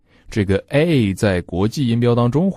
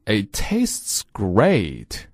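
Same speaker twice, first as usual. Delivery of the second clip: It tastes great drawn out very slowly; slow, deliberate teacher reading; very slow model; much slower than usual. Shown as a demonstration. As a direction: stretch every word for learners. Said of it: "并且跟读熊叔。".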